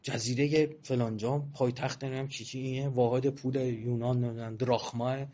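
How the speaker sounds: ordinary talk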